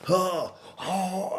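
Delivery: mock shocked gasp. Shocked listener reacting.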